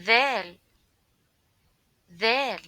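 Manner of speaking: loud clear Dal; clear teaching model. Shown as a demonstration. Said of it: "Repeat after me".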